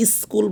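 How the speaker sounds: স্কুল with a stray opening vowel, "iskul"; mispronounced school. Shown as a demonstration. Teacher warning that this is wrong, mispronounced school. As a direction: put a wrong "i" before স্কুল. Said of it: "বললে উচ্চারণটা ভুল হবে".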